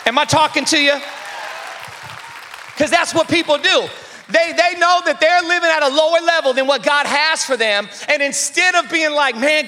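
full-force preaching. Acted. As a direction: preach it hard to the room.

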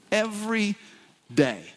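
"Every" hit high with a pause before "day"; "Every" up high, "day" set apart; stress on Every. Real, not acted. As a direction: punch "Every", then let "day" drop. Stressing daily love.

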